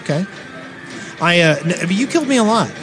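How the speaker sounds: ordinary delivery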